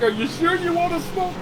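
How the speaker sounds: high, mocking mother's question; taunting mom. Mom taunting her son, high mocking voice. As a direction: needle him sweetly.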